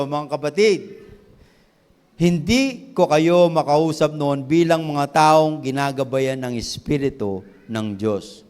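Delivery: loud, slow preaching of the verse; preaching scripture. Acted. Pastor reads First Corinthians to the congregation, loud slow preaching. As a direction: proclaim the verse with full force, slowly.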